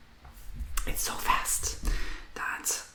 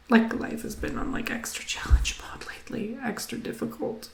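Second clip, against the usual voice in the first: emotional; 7 semitones higher, 6 dB louder than usual. Real, not acted. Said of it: "I'm getting emotional with you too".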